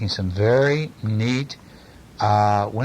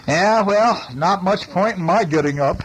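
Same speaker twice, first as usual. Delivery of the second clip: grumpy little helicopter; 7 semitones higher, 3 dB louder than usual. A narrator's reading. Voicing Budgie grumbling in bed.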